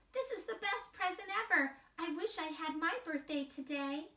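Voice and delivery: put-on high small child voice; little mouse voice. Wemberly peeking at her parents, high and timid.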